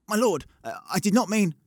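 put-on flustered stable boy caught red-handed; caught out stammer. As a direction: stumble over the excuse.

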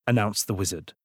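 ordinary delivery

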